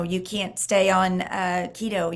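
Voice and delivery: ordinary talk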